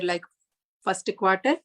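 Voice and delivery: ordinary talk, nothing marked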